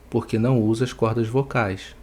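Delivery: usual speaking voice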